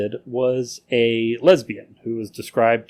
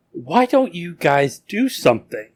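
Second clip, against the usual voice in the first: mild, higher-pitched mock shout; retold shout; 5 semitones higher than usual. Beaten woman appeals to the watching crowd, in a retold shout. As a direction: imitate a plea to a crowd without really yelling. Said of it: "and she shouts".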